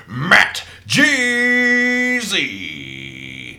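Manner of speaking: long, high, loud name call; hype announcer. Acted. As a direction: stretch the name like a ring announcer.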